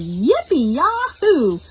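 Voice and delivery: slow high whoop; joyful farmer shout. Acted. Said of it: "he shouted".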